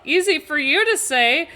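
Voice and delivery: high, loud retort mid-fight; indignant retort. Acted. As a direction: throw it back at the attacker. Retort during sword fight.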